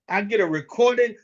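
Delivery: ordinary delivery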